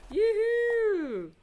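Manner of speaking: high, loud whoop of delight; excited cheer. Real, not acted. Thrilled painter cheering over her new magnetic paint, loud whoop. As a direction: whoop it out happily.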